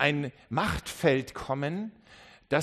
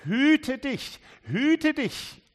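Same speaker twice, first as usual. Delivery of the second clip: higher, louder repeated warning; Jesus repeating his warning louder; urgent warning; 9 semitones higher, 4 dB louder, much slower than usual. Acted. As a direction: press the warning home. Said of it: "sagt Jesus".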